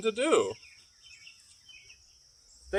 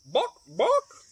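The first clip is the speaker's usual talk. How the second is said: high clucked buck buck; clucking hen; 10 semitones higher than usual. Acted. Hen clucks at the librarian, high and clucky. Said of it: "the hen clucked".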